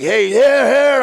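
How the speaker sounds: high, loud mock protest; alarmed call. Retelling his reaction to the chief's bong, alarmed call.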